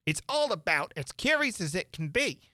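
high, odd creature voice; griffin voice. Acted. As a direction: squawk it like a gruff beast.

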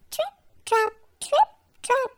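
high, light trip-trap; light tapping bridge sound. A narrator's reading. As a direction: tap it out tiny and quick. Bridge sound, tiny goat.

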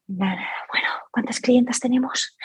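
whispered, worried self-talk; anxious whisper. Retold or imitated. Recalling her old anxious self, whispering worriedly. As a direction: fret under your breath about the numbers. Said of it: "probablemente estaría".

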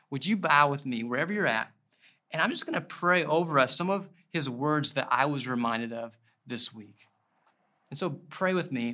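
ordinary talk, nothing marked

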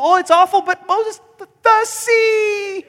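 hammed-up fearful whimper; terrified Israelites. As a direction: stammer it out, frozen by the sea. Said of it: "They're terrified of the sea".

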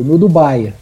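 ordinary talk

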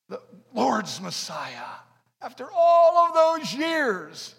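slow, loud preaching with big swells; fiery preaching. Acted. Pastor preaching to his congregation, slow and loud. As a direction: preach it to the crowd.

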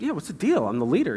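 high-pitched indignant outburst, mildly acted; retold furious kid. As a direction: challenge dad like a miffed child. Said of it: "Now I'm just furious".

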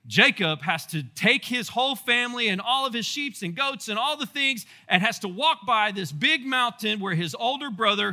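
ordinary talk

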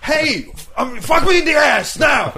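loud, high-pitched yelled demand; hammed-up heckler shout. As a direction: bellow it like a rowdy drunk heckler. Acting out a drunk heckler's demands.

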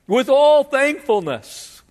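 high, loud and slow grand bow of words; pompous flatterer. Acted. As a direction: lay it on thick, slow and lofty. Preacher voicing flattering lawyer.

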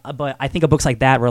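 usual speaking voice

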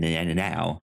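normal talking voice

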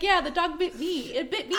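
high, loud imitated insistence; insistent owner. Bitten owner explaining herself to vet staff, mock insistence. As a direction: imitate an indignant bite victim, half laughing. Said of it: "And she was like".

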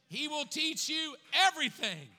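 shouted high and loud, every word hammered; joyful preaching shout; full-force preaching shout. Real, not acted. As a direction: proclaim it at the top of your voice. Preacher repeats key verse, shouting.

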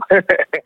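короткий смех; смеётся после фразы; laugh. Real, not acted. (смешок)

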